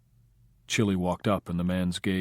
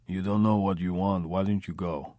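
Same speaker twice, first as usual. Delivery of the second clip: put-on quiet guttural Russian accent; lightly voiced Russian gangster, guttural; Russian loan shark brushing off the question in a guttural Russian accent. A narrator's reading. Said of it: "the tone quiet, guttural".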